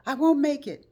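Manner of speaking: high, loud shaken voice; panicked friend. Retold or imitated. Friend calling right after being hit, shaken. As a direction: blurt it in shock. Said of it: "She said".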